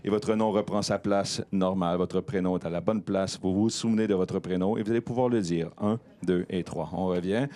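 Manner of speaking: low, even hypnotist voice; soothing induction voice; hypnotic calm. Acted. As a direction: guide them back softly. Hypnotist waking subject.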